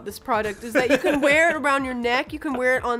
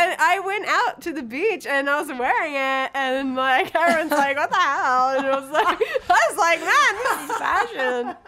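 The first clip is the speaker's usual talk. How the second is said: put-on higher-pitched Valley Girl storytelling; Tiffany; 5 semitones higher than usual. Valley Girl recounting her beach fashion moment, Valley Girl. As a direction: lilt upward like a gushing Valley Girl. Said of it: "do you talk in that voice".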